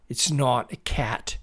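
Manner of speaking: usual speaking voice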